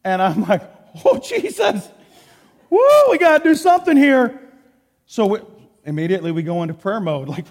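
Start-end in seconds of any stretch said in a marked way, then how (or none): 1.0-4.4 high, laughing yelp of alarm, louder on the second line; panicked laughing cry. Retold or imitated. Preacher retelling his panic when the air conditioning died, high and laughing. AC goes out in Texas.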